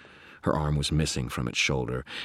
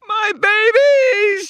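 long, very high piercing shriek; screaming ghost; 11 dB louder, much slower than usual. Acted. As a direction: wail it out at full scream. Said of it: "she screamed".

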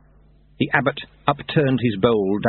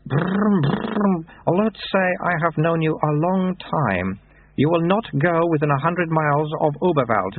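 put-on high, childlike abbot voice; baby abbot; 8 semitones higher, slower than usual. A narrator's reading. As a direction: speak like a toddler with an old man's words.

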